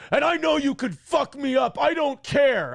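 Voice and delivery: put-on defiant drunk. Drunk friend getting in his face, daring him, mock voice. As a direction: puff up and dare him. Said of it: "he goes".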